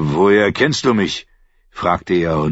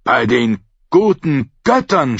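louder shocked exclamation; shaken; 5 semitones higher, 4 dB louder than usual. A narrator's reading. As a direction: blurt it out shaken. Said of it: "stieß er erschüttert hervor".

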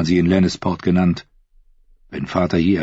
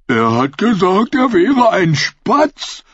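very high, slow dim-witted voice; simple fool voice; 13 semitones higher, 4 dB louder, slower than usual. A narrator's reading. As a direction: play a slow-witted ragged peasant.

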